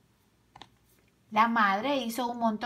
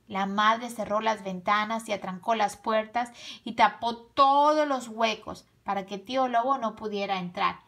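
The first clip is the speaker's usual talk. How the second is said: usual speaking voice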